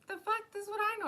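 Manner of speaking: squeaky high confused; high mock confusion; mock bewildered. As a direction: act baffled, way up high. Mimicking his confused reaction.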